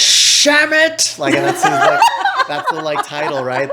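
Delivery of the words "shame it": high yelped Sham it, loud and sudden; old man's yelp. Retold or imitated. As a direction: yelp it like a fallen old man. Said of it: "he's like".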